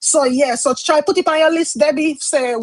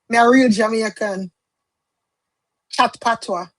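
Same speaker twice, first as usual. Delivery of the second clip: lightly voiced Jamaican patois, a slightly different tone; a brief proud line of Jamaican patois; 3 semitones lower than usual. Shown as a demonstration. To her viewers, showing off her Jamaican patois. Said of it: "you know I talk patois".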